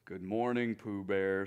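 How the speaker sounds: low, gloomy donkey voice; gloomy Eeyore. Acted. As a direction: answer without a shred of hope. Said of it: "said Eeyore gloomily".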